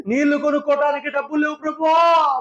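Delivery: high shouted plea to the Lord; desperate prayer shout; crying out prayer. Shown as a demonstration. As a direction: cry out for the thirsty people. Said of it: "shouted plea in prayer".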